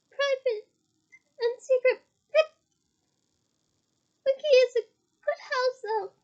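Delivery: high, loud, tearful elf squeak; a drunk elf sobbing over her secrets; high tearful elf rant. A narrator's reading. Tipsy Winky rants.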